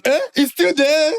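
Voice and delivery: high, slow put-on voice of the doctor; high, slow mock doctor voice; doctor's voice. Doctor voiced in retold scene.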